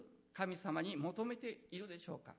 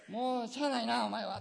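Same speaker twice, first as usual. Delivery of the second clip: louder sigh-like Kansai give-in; 大阪弁で仕方なく; grudging Osaka dialect; 4 semitones higher, 7 dB louder than usual. Pretend. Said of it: "っていう感じですね".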